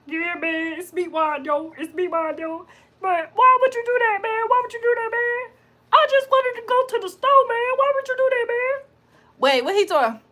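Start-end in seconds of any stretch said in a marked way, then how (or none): 0.1-8.9 high cartoon creature voice, climbing higher. Cartoon character protests to a man, high-pitched impression. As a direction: squeak it out like a complaining cartoon meatball. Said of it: "[name] sound like Meatwad".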